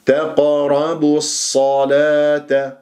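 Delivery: تقربوا الصلاة chanted slowly, bu running straight into the heavy sad; joined recitation. Shown as a demonstration. Teacher models joining the words, slow recitation. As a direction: link تقربوا into الصلاة, dropping the long u. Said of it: "فتصبح كأنه بصة".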